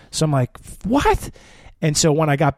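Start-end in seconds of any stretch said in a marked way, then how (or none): 0.9-1.3 high, rising question; stunned disbelief. Retold or imitated. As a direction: ask it in pitched-up shock. Retold shock at bad news.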